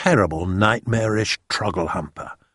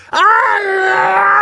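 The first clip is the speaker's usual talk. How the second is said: very high, loud drawn-out howl; giant's roar; 7 dB louder than usual. A narrator's reading. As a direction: roar out in nightmare terror. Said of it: "roared the flesh lump eater".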